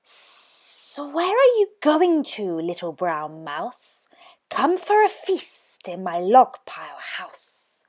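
slither the words out softly; sly snake. Acted. Snake tempts the mouse, soft and sly.

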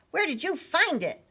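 high, mildly insistent question; demanding. Acted. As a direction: demand an answer, storybook style. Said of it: "Little Blue demanded to know".

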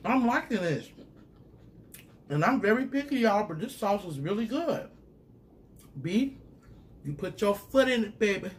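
thrilled by the taste; excited. Real, not acted. Tasting, delighted by the sauce.

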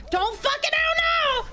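high, sustained shouted plea; desperate high-pitched command; panicked screaming. Real, not acted. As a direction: scream it high all the way through. Begging the ball again, higher.

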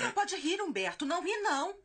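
high-pitched motherly protest; scolding. Acted. Mother telling son not to laugh at her gossip, scolding.

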